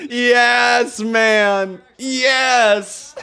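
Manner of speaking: high, loud celebration; elated cheer. Real, not acted. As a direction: cheer it out, thrilled. Celebrating the successful French test.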